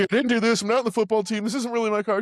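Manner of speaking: high, mock-nervous blurting; panicky confession. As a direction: blurt it all out in a hammed-up panic. Acted nervous confession by a catfish.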